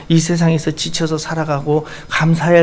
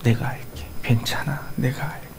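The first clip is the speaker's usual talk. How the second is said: hushed, softer whisper of reassurance; God whispering comfort to the weary; 4 semitones lower, 8 dB quieter than usual. Acted. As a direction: breathe the promise gently. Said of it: "주님의 음성을 들어보세요".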